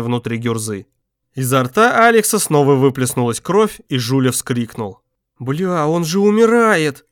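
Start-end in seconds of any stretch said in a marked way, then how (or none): 5.4-7.1 slow, high whining plea; plaintive. Acted. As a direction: whimper it out in panic. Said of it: "жалобно проговорил он".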